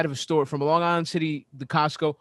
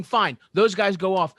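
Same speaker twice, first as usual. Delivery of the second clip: ordinary talk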